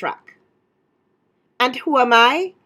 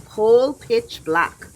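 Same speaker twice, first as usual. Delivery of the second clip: usual speaking voice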